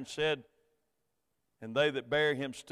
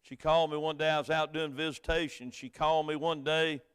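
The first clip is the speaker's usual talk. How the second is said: ordinary talk, nothing marked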